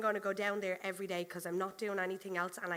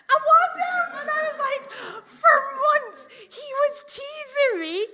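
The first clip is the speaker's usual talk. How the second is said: real laughter, much higher and louder; laughing; 14 dB louder than usual. Real, not acted. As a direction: speak through fits of laughter. Cracking up retelling.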